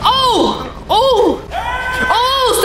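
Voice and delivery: high startled "oh" cries; startled yelps. Real, not acted. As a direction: yelp high in shock. Startled by the film's scare.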